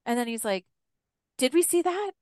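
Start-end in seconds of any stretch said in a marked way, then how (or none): 1.4-2.1 high-pitched imitation of stunned wonder; retold disbelief. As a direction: question it as if doubting your eyes. Retells husband's stunned disbelief.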